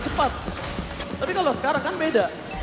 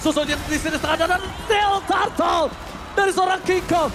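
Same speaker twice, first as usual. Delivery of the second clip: excited play-by-play; 5 semitones higher than usual. Real, not acted. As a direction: hype up the turtle fight. Caster calls turtle fight.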